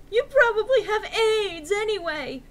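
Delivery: high loud scornful shout; hammy stage actor. Acted. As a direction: melodramatic yelling. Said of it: "I shouted".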